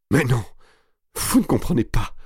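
high cry through sobs; tearful cry. A narrator's reading. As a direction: cry out as if sobbing. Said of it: "cria enfin Marie à travers ses sanglots". (cri à travers les sanglots)

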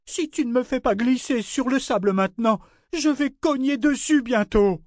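high, strained, heated complaint; angry protest. A narrator's reading. Ship arguing with foreman about the rock, angry protest. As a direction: argue back hotly in a high voice.

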